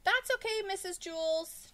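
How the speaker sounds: high, loud schoolboy call. A narrator's reading. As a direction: call out eagerly to the teacher. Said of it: "Jason called".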